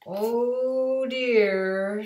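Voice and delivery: loud, high drawn-out oh dear; the cat sighs theatrically; mock sorrowful cat. Cat mocks the boy for disliking the game. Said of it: "said the cat".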